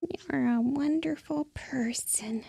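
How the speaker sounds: soft mouse voice. Pretend. Repeats the mouse's line, very softly.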